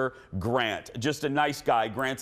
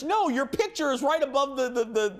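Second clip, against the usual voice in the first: much higher mimicked friend voice; mimicking friend; 12 semitones higher than usual. Friend explaining the billboard in the stall, higher mimic. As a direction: explain it breathlessly. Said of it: "And she says".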